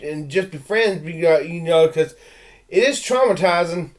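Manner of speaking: normal talking voice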